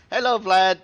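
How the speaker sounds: high, loud, teasing hello; mock greeting. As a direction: sing out a loud, high hello to taunt him. Taunting the enemy Vlad.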